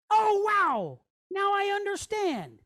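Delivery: high, acted "aha" moment; sudden realisation. Teacher voices letter figure getting an idea, high amazed realisation. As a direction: play a light bulb going on, pitched well up. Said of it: "Like, he gets an idea".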